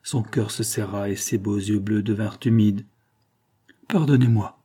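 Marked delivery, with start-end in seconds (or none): 3.9-4.6 « Pardonnez-moi » lancé avec élan; s'écrie. A narrator's reading. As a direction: demander pardon dans un élan. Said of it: "s'écria-t-elle". (la mère demande pardon à Véronique dans un élan)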